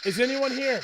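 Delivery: calling out to spirits. Acted. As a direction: call out into an empty room. Asking the spirit box questions.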